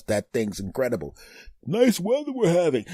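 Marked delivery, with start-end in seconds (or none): 1.7-2.8 high, light small-talk voice; chirpy chit-chat with a coworker; polite small talk. Retold or imitated.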